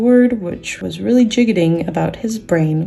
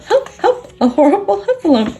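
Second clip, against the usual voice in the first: crying out in terror; 13 semitones higher than usual. A narrator's reading. Piglet scampers off, still shrieking in high panic. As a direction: scream it while running away. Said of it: "still crying out".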